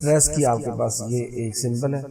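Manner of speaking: ordinary talk, nothing marked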